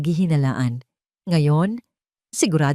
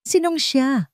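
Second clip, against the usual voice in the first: insisting; 8 semitones higher, slower than usual. A narrator's reading. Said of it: "giit ni Liam".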